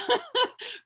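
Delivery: a quick high burst of laughter; amused high giggle. Real, not acted. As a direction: laugh it off brightly. Laughs at own answer.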